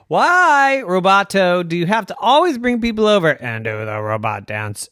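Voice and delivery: loud whine, starting high; exasperated complaint. Acted. Evan complaining to his robot about guests, exasperated. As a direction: protest loudly, dragging out the first word.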